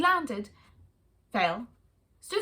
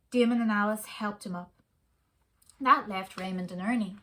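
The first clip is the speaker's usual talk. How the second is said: plain reading voice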